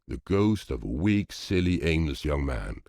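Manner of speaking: plain reading voice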